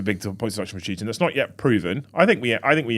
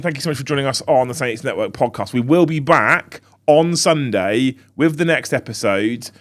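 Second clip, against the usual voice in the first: ordinary talk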